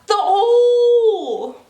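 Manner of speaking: very high, loud, long-held French "O"; insistent French-accented shout. Retold or imitated. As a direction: holler it back, stretching the vowel. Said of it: "She's like".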